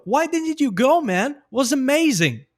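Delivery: high rise on "go", then a high, falling "amazing"; same intonation, English. Shown as a demonstration. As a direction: sing the tune up on "go" and stretch "amazing". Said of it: "Mesma entonação".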